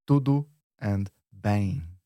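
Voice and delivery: "tudo" and "bem" slowly set apart; slow word-by-word "tudo ... bem"; two separate words. Shown as a demonstration. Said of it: "Two separate words".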